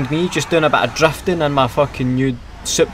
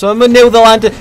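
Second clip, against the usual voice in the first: high, loud triumph; excited shout; 9 semitones higher, 10 dB louder, faster than usual. Real, not acted. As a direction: cheer the landing. Celebrating landing the jump.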